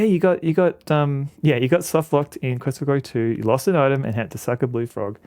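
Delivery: usual speaking voice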